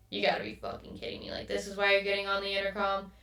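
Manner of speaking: ordinary delivery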